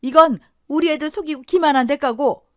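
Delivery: high, loud accusation; angry reckoning. Acted. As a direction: punish him with every word. Enraged wife hitting her husband.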